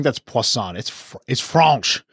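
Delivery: hammed-up bad French accent; terrible French accent. Co-host correcting the other host's pronunciation, terrible French accent. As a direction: put on a mock French accent, drawing out the words. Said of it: "my terrible French accent".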